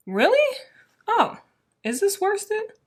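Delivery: sudden high-pitched disbelief; surprised. Real, not acted. Checking the label, she gasps in high surprise. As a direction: caught off guard by the label, pitch jumping up.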